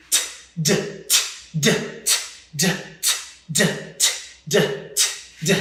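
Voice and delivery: crisp voiceless "T", then short voiced "D"; tongue-tap drill on "T" and "D"; alternating T and D sounds. Shown as a demonstration. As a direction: switch between "T" and "D" as bare consonants. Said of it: "let's alternate between T and D".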